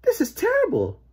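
high-pitched dismay; alarmed mother. A narrator's reading.

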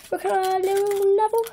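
cartoon impression. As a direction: toss it off in a half-hearted cartoon voice. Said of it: "Family Guy impressions".

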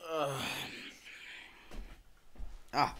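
ordinary talk, nothing marked

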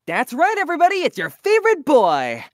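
high, loud, slowed showman's boast; hammed-up announcement. Voice actor performing a requested scene, loud showy boast. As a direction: boast like a cocky hero making an entrance. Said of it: "They want you to do this scene".

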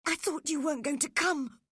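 high, quick, anxious voice; frightened boy. A narrator's reading. Frightened Linton to Cathy on the moors, anxious.

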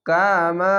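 ordinary talk

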